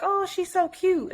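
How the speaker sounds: high, cutesy coo over the cat. Retold or imitated. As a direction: gush over a sleeping kitten.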